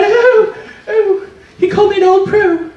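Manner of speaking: shrill, high, loud mock horror; horrified mom. Mom telling dad in shocked, high mock horror. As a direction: squeal it out in shocked disbelief.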